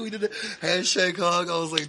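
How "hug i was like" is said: ordinary talk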